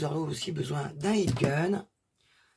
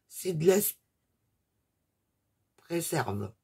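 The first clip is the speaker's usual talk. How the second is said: English words in a real French accent; 2 semitones higher, much slower than usual. Real, not acted. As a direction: say the English name with your own French accent. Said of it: "moi, j'ai mon accent anglais". (anglais avec un accent français)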